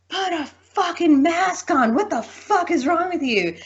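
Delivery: high, slow, furious mock yelling; angry yell. Big guy in Walmart yells at her, mocking furious yell.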